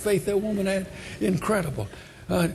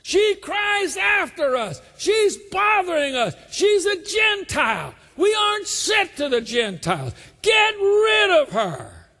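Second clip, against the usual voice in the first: high, loud indignant shouting; acted outburst of irritated followers demanding action; scolding disciples; 3 dB louder than usual. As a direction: shout it as a harsh complaint, pitch way up. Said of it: "The disciples have scolded her".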